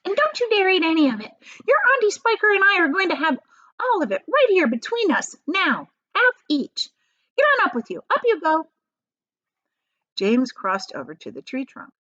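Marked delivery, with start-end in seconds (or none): 0.0-8.7 put-on high, fast, bossy old aunt. Aunt Sponge bossing James up the tree, high and fast. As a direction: rattle off orders to the boy.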